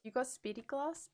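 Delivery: usual speaking voice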